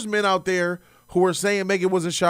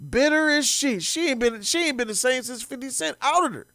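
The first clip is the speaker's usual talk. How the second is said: usual speaking voice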